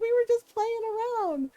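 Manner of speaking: mock whiny kid voice; pleading brothers. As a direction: whine it like a caught child. Scared brothers excusing themselves.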